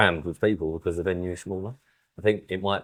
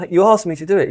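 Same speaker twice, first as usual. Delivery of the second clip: higher, louder, playing his own outraged self; mock indignant protest; 11 semitones higher, 8 dB louder, faster than usual. Retelling to co-host his protest to Tom, mock indignant. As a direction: protest like you've been wronged. Retold spat with show organiser.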